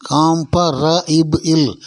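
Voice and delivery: காம்பரஇப் இல் slowly broken into kaam-pa-ra-ib-il; syllable by syllable. Shown as a demonstration. Teacher breaks comparable down for learners, slow syllable by syllable. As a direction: stretch each sound of காம்பரஇப் இல். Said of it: "அப்படின்னு சொல்லணும்".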